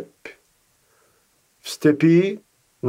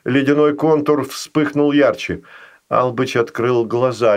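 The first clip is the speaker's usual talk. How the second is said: plain reading voice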